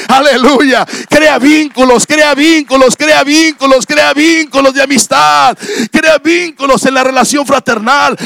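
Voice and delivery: loud, high, building exhortation; fiery pulpit crescendo; full-force preaching. Acted. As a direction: preach it hard, hammering each repeat.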